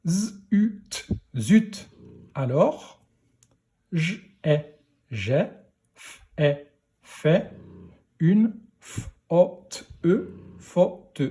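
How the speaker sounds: each sound isolated, then blended into zut, alors, j'ai, fait, faute. Shown as a demonstration. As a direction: decode word by word with pauses, from single sounds to faute. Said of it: "pour la lecture de la phrase".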